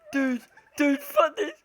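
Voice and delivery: ordinary talk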